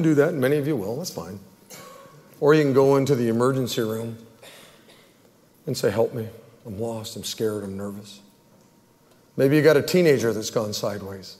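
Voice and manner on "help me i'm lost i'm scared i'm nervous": softer with each plea, down to a near hush; small voice asking for help; pleading, fading. Retold or imitated. Pastor voicing a scared congregant, quiet fading plea. Frightened person asking for prayer.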